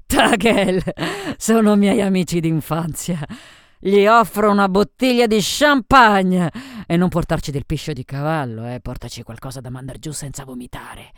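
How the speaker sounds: boisterous tipsy woman giving orders; rowdy drunk Sophie, brash and bossy. A narrator's reading. Story's drunk Sophie ordering champagne.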